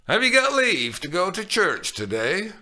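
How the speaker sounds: loud, knowing question; nurse asking. A narrator's reading. Nurse slyly asks Juliet about church. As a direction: ask with a sly hint.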